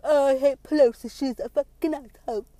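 loud mocking complaint of an angry partisan; mock outrage. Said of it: "the Trump people are like".

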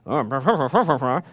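mock babble. Mocking religious leaders' chatter.

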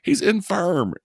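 hammed-up high old woman pleading; old lady voice. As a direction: squeak it out like a fragile grandma. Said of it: "she was like".

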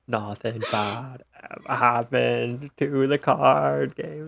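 slow, drawn-out mock presidential voice; bad JFK impression. Podcaster mocking the dubbed actor's accent, in a slow, bad JFK impression. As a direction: stretch it out like a poor JFK impersonator. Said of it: "a really bad impression of J F K".

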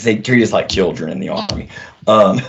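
ordinary talk

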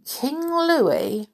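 high questioning, wary; suspicious. Acted. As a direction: doubt the name warily. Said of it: "Mowgli said suspiciously".

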